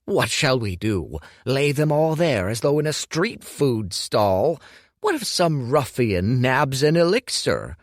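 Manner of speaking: Hu protests to his apprentice, appalled; pompous old master, appalled. Acted. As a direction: sound outraged at such vulgar ideas. Voicing fussy alchemist Hu.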